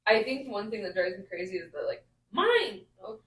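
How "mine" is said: high, sharp-rising claim; pitched-up toddler voice; imitating possessive toddler. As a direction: claim it like a small child.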